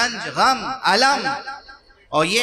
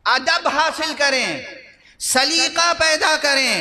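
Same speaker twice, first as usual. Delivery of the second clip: preaching; 4 semitones higher than usual. Acted. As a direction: lament to the majlis with rising fervour. Exhorting the congregation from the pulpit. (پرجوش خطابت، دھیمی اور بلند)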